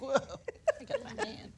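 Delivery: very high, stunned "Whoa"; mock shock. Retelling her shock at getting the hand, very high. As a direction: squeal it out in amazed disbelief. Recalling shock at being dismissed.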